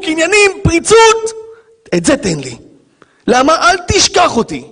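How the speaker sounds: God asking for your hardest struggle. Acted. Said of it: "אומר הקדוש ברוך הוא".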